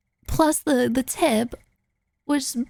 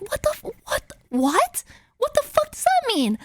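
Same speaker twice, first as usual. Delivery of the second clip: high-pitched incredulous what; acted-out stunned confusion; baffled disbelief; 11 semitones higher than usual. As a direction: let the pitch leap up in bewilderment. Baffled reaction to the driver.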